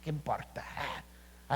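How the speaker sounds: a breathy, careless shrug of a voice; dismissive scoff. Retold or imitated. Scornful churchgoer waving off God's word, whispered scoff. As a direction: brush it off like it doesn't matter. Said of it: "como dice".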